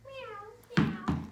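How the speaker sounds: high, loud mewing meow; playful kitten meow. Acted. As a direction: meow like a little kitten for the child. Cat sound in picture book.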